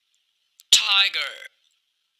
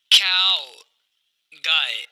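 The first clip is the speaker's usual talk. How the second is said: ordinary talk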